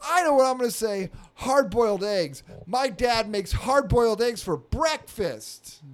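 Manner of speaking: put-on high, proud little-boy announcement; kid voice. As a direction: declare it like an earnest child. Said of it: "And then he just goes".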